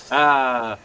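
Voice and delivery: normal talking voice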